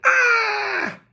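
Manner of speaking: very high falling howl; troll howl. Acted. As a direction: howl as he's swept away. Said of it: "howled the troll".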